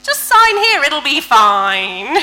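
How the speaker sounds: high, loud and dragged out, mock cheerful; hammed-up doctor brushing off the risks, slow at the end; mock breezy reassurance. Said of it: "on the form, it says".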